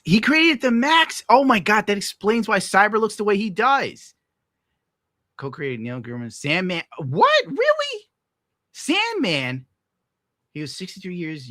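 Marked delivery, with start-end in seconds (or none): 0.0-4.1 much higher and louder, slowed; excited realization. Real, not acted. Learning who created the Max, high excited realization. 7.0-9.7 much higher, squeaky disbelief; can't believe it; astonished. Real, not acted. Hearing he co-created Sandman, high astonished disbelief. Surprised by chat fact.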